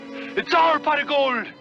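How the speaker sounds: slow, high, loud triumphant cry; joyful shout. Acted. Finds the gold.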